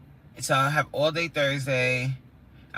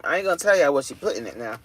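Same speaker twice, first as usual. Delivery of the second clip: usual speaking voice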